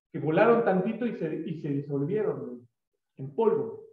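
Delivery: ordinary delivery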